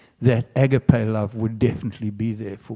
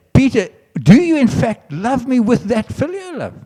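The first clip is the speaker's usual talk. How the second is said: Jesus pressing Peter; 10 semitones higher than usual. Acted.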